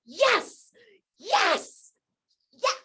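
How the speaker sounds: high, loud, rising cheers of yes; excited yeses. Shown as a demonstration. Coach showing her morning routine, shouting yes excitedly. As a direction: pump yourself up with each yes. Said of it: "I literally go".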